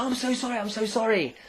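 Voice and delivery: high-pitched mock apology; frantic apology. Stranger who crashed into a man, high mock-frantic apology.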